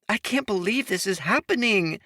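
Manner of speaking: high, giddy disbelief; excited. A narrator's reading. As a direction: burst out with glee. Imogen bursting with joy.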